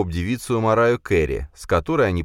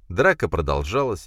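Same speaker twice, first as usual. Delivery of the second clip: ordinary delivery, nothing marked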